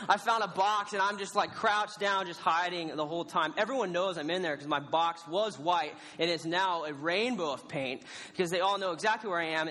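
usual speaking voice

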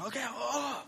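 high, alarmed reaction; high-pitched mock fluster; flustered doctors. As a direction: imitate doctors startled into action.

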